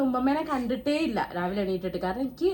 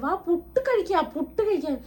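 mock-scold her to come eat puttu; husband scolding; 6 semitones higher, 3 dB louder than usual. Husband urging sick wife to eat, mock scolding. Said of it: "ഏട്ടൻ ചീത്ത".

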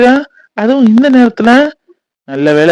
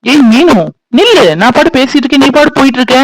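loud, higher shouts to stop her; calling her back; 7 semitones higher, 3 dB louder than usual. Acted. Man yelling at Meenu to stop walking away, loud calling out. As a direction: yell after her as she walks off.

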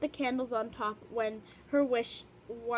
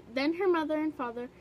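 ordinary delivery

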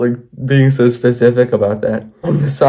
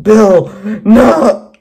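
high cry of protest; acted yell; 9 semitones higher, 4 dB louder than usual. Henry sees the axe swung, acted yell. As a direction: yell it out in alarm. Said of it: "Henry yelled".

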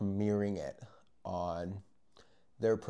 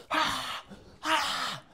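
very high, short acted screams; two loud high-pitched mock shrieks; freak-out screams; 4 dB louder than usual. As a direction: reenact freaking out in bursts. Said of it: "freaking out".

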